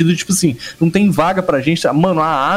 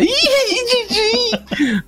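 high squeaky laughing comic voice; Zacarias; much slower than usual. Retold or imitated. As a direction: squeal it like the old comedian. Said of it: "ele parece o Zacarias". (voz do Zacarias rindo)

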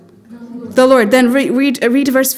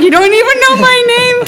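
incredulous retort; 11 semitones higher, 4 dB louder, faster than usual. Pretend. Imagined retort to a flatterer.

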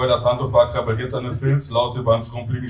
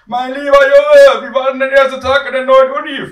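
high, loud mum on the phone; gushing mother; 14 semitones higher, 10 dB louder than usual. Acted. Mother phoning her son after first day, high and loud. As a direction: pipe it out loud and far above your own pitch.

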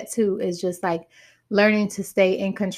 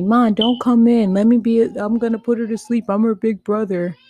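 imitating her son; 3 semitones higher, 7 dB louder than usual. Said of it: "He be telling me".

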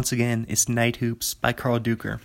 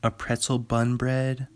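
ordinary talk, nothing marked

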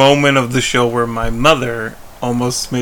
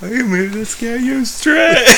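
mock yell; 10 semitones higher than usual. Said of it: "I've said that".

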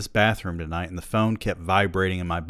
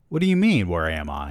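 indignant; 3 dB louder than usual. Acted. Friend on the phone puzzled at the question, indignant.

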